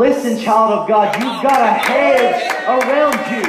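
high, loud proclamation; preacher thundering reassurance; full-force preaching. Acted. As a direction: assure them with fire. Preaching to the congregation.

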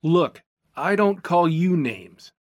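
slow, controlled complaint; measured reply. A narrator's reading. Keith complains to Gina, slow and measured. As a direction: say it slowly, holding back.

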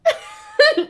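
high, loud burst of laughter. Real, not acted. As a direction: crack up at the idea. Reacting to the British accent idea.

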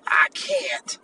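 breathy mock whimper of a quitter; mock their weakness under your breath; hushed mocking impression of a quitter; mocking whine. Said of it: "You up here talking about some".

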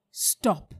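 loud, commanding halt; sharp stop. Acted. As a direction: stop him at the door. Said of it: "said the witch".

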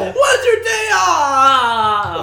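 frantic cry. Acted. Mock frantic workplace quote.

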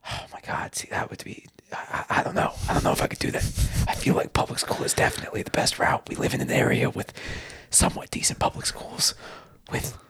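gravel-free hushed whisper, Batman style. Pretend. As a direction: whisper like a brooding superhero. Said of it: "your A S M R voice sound like you're trying to whisper as Batman".

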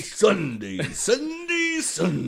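loud hype announcer chant; Sunday Sunday like a radio promo; monster truck ad. Acted.

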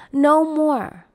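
loud, high and emphatic. Real, not acted. Telling her viewers to quit putting up with it, loudly. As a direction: hammer it home as a call to stop.

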